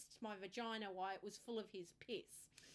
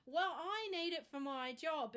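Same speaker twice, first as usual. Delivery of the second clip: firm, slow and louder, higher; insistent; 6 semitones higher, 6 dB louder, slower than usual. Retold or imitated. Patient arguing with the pathologist, slow and insistent. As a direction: dig in and argue the point. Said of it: "we're having this argument".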